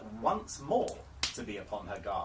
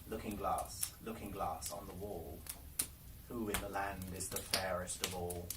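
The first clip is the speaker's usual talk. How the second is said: hushed question to mirror; 5 semitones lower, 5 dB quieter than usual. Acted. The Queen asking her mirror again, hushed and low. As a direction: lower and softer, hushed and expectant.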